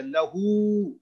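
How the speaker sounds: लहू with the final u held long; stretched vowel. Shown as a demonstration. Teacher shows the two-count madd, लहू stretched. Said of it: "थोड़ा सा stretch करना है इसको".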